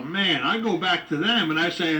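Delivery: ordinary talk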